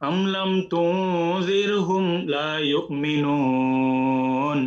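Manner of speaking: very slow recitation, last vowel of ইউমিনুন held long; stretched ending. Shown as a demonstration. Teacher shows the long madd when stopping at a verse end. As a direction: hold the ending of ইউমিনুন for three alifs, slowly. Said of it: "একটু লম্বা করবো".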